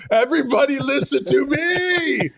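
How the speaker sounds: high, drawn-out mock shout; all-caps shouting. Host imitating an all-caps chat commenter, mock shouting. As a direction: holler like a chat message in capitals. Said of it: "All caps".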